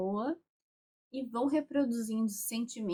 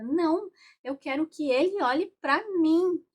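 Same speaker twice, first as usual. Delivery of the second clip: higher and louder, a mock-upset demand; unhappy demand; 8 semitones higher, 9 dB louder than usual. Representative demanding her father's gaze, upset and loud. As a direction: insist like a sulking child who wants attention. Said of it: "não tava nada feliz, nada mesmo".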